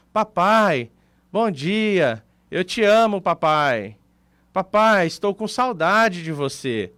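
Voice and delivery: tender, unhurried message to dad, words drawn out; normal-speed daughter. Retold or imitated. Daughter's voice message to her dad played at normal speed, slow and tender. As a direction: say it lovingly, leaving space between phrases. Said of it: "Se você escuta ela normal".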